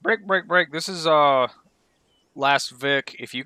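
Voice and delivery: high, loud interrupting call-out; loud radio break call. Real, not acted. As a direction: cut in on the radio forcefully. Cutting into busy radio net.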